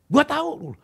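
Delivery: sharp high snap; fierce retort. Retold or imitated. Pastor snapping at Robi, high fierce retort. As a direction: bite back fiercely. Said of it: "Galak dia".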